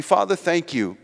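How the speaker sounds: gentle earnest prayer voice; closing prayer. Acted. Leads closing prayer over music.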